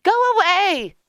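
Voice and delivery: very high, loud shout; high-pitched woman character, yelling. A narrator's reading. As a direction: shout callers away in a high voice. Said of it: "she shouted".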